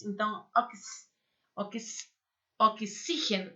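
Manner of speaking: "ox-" built up softly as "oks", then "oxígeno"; sounding out X. Shown as a demonstration. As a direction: break off after "ox-", pause, then say "oxígeno" whole. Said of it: "se chama X".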